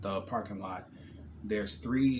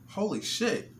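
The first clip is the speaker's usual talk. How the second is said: stunned; 9 semitones higher than usual. Retold or imitated. Sees flames.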